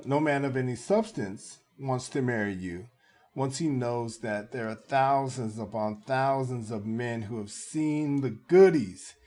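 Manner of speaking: ordinary delivery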